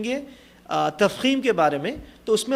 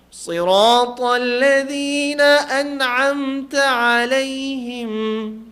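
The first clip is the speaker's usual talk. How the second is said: slow, drawn-out chanted tilawat, higher; Quran recitation; 5 semitones higher, 6 dB louder, much slower than usual. Shown as a demonstration. Teacher reciting Fatiha to class, high slow chanted tilawat. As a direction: lift the chant and stretch every vowel.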